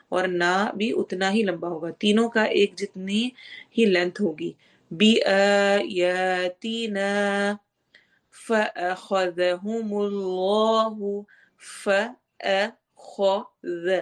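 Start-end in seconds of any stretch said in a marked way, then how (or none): 4.9-13.9 slow chant with heavy kh; slow Quran recitation. Shown as a demonstration. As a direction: chant فأخذ with a thick خ. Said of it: "خو موٹے حروف میں سے ہے". (موٹے خ کے ساتھ تلاوت)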